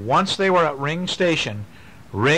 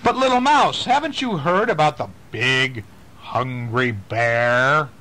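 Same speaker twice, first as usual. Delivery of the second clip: ominous warning voice. Acted. Reading to children, warning the mouse in a slow spooky tease. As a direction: draw out the danger to tease.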